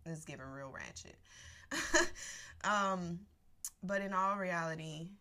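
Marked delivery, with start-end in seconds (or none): 1.2-2.6 airy high laugh; breathy laugh. Real, not acted. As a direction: let out an unvoiced laugh. Laughing after ratchet joke.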